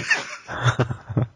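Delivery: breathy real chuckle; soft laugh. Real, not acted. Friend laughing at the sitcom bit, soft laugh. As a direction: laugh quietly at the story.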